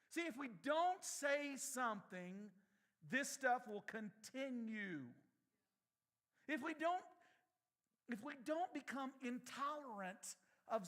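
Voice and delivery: fired-up preacher; preaching. Acted. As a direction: preach it hard to the congregation. Preaching at full force.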